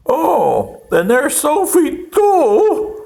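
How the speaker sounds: a hammed-up little schemer spotting his target; mock Princip. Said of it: "and he said".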